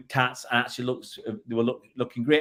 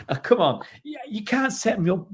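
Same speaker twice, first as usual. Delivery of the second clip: laughing protest; 9 semitones higher than usual. Real, not acted. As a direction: laugh through a flattered brush-off. Laughs at flattering line.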